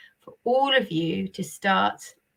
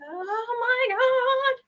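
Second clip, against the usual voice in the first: very high, slow, drawn-out oh my God; delighted squeal; much slower than usual. Real, not acted. Host thrilled at the offer of a free book. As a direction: gasp it out in thrilled disbelief.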